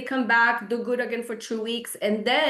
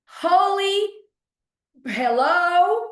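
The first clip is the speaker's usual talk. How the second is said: indignant disbelief; 7 semitones higher, 5 dB louder, much slower than usual. Real, not acted. Real indignation at rude check-ins.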